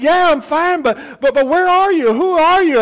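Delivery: high, loud stammered questions; calling up anxiously. Acted. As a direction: shout up to an unseen stranger. Stranded man calls up, puzzled.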